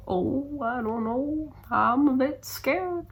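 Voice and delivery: high, slow, mock-timid inner voice; playing up her own nerves, slowed right down; mock nervous. Telling viewers about pre-trip nerves, mock scared and high. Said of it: "kinda got me a little bit like".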